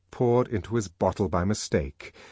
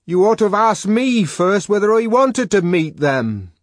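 high, loud indignant shouting; exasperated shout from behind a door; shouted retort; 13 semitones higher, 10 dB louder than usual. A narrator's reading. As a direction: yell the reproach back through the door. Shouted quarrel through locked door.

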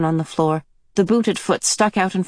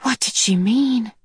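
whispered, then slow; hushed inner thought; 3 semitones higher, slower than usual. Acted. Meg wondering to herself, hushed and slow. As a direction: wonder it under your breath.

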